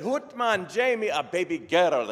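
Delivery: excited Scottish doctor, high and loud; Scottish brogue. Acted. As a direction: announce it joyfully in a put-on Scottish brogue. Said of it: "best Scottish brogue I can come up with".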